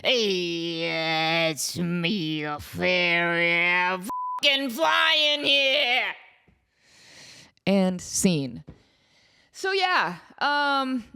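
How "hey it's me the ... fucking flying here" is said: slow, languid fairy voice; slow, drawling fairy. Shown as a demonstration. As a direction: drag the words out lazily. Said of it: "another fairy take".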